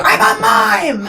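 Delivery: high, slow, loud shout; yelling. Retold or imitated. Mime yelling at partygoers all night, loud shout. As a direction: bellow it out like a proud mime. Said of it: "yell at everybody all night and be like".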